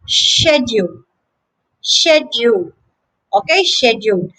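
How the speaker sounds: SHED-yool; British "schedule", sh in place of sk; British pronunciation. Shown as a demonstration. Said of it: "The British pronunciation is".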